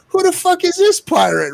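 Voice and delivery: high, loud incredulous outburst; baffled outrage. Retold or imitated. Streamer retelling his reaction to the angry troll, high incredulous. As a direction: exclaim in high disbelief. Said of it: "I'm like".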